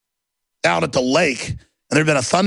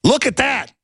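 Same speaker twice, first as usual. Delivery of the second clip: higher, sharp exclamation in the astronaut's voice; quoting the astronaut; 8 semitones higher than usual. Retold or imitated. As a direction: fling it out like the astronaut scolding a politician. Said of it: "just like the astronaut did".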